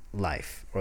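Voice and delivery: ordinary talk, nothing marked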